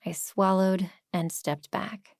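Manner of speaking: ordinary delivery, nothing marked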